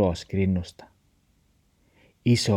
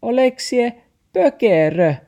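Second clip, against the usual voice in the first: very high, loud taxi voice; barked scolding; 6 dB louder than usual. A narrator's reading. Taxi scolds Max after near miss, shrieked insult. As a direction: snap it like a yappy bark. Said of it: "taksi haukkui".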